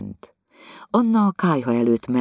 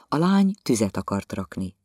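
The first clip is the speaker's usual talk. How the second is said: plain reading voice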